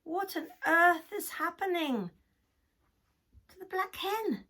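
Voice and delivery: high, loud mooing voice; cow character. Acted. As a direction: wonder aloud as a puzzled cow. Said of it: "said the cow".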